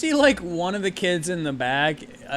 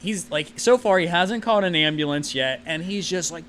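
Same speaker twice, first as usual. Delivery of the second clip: ordinary talk, nothing marked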